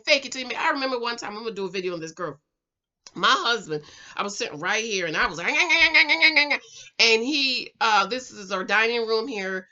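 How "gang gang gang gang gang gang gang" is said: rapid high yapping imitation; higher, louder mock yammering; mock nagging. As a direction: imitate your own nagging as loud repeated noise. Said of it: "Could you please watch your tone".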